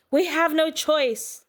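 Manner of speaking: high, slow, desperate cry; acted high-pitched urgency; urgent cry. A narrator's reading. Urgent cry in chase scene.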